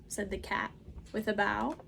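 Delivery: ordinary delivery, nothing marked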